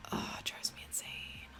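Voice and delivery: breathy, exhausted whisper; exasperated whisper. Real, not acted. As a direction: sigh it out, spent. Whispered exasperation near tears.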